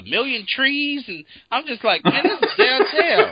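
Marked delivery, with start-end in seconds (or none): 2.0-3.3 high and loud, slow, laughing through every word; laughing disbelief. Retold or imitated. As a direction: laugh out the disbelief at the fake downtown. Retold disbelief at the game's city.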